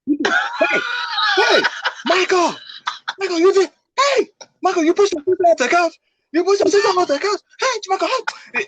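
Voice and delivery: very high screeching angry mom; furious mom. Retold or imitated. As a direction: mom shrieking at her son.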